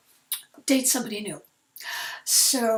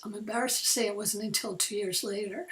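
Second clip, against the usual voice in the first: usual speaking voice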